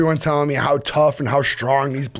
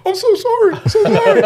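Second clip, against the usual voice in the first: high, laughing, a bit louder; laughing apology; 5 dB louder than usual. Retold or imitated. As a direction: apologise through the giggles.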